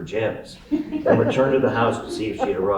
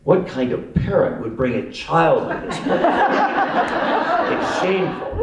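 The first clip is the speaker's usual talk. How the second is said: mildly scandalized, voice raised in pitch; pointedly audible; 7 semitones higher than usual. Acted. Prankster uncle mock-scolding so all can hear, pointedly audible. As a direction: make a show of being appalled. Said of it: "said loud enough for all".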